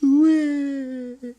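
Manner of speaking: high, hammed-up baby wail; mock crying wail. Sculptor as a crushed kid, mock high wail. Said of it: "And he'd be all like".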